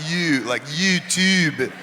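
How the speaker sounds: drawn-out "you" and "YouTube"; held-out you. Shown as a demonstration. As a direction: stretch the vowel in "you". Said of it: "you have to hold out".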